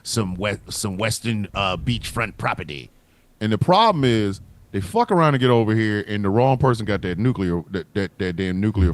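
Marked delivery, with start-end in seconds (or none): 0.0-2.9 much lower and softer sly villain voice; low, quiet, plotting tone; scheming foreign powers. Retold or imitated. Comedian voicing Russia and China eyeing land, sly low villain. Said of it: "They might go".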